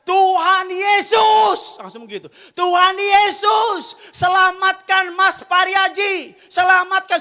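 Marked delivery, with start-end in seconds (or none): 0.1-1.7 long, high, full-voiced cry; re-enacted shout. Pastor's wife suddenly calling on Jesus, re-enacted as a drawn-out shout. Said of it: "langsung teriak". 2.5-7.2 slow, high, very loud plea; re-enacted shouted prayer. Pastor's wife praying for people to be saved, re-enacted as a high, drawn-out shout. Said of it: "langsung teriak".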